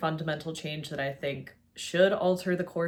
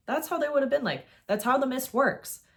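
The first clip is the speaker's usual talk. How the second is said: ordinary delivery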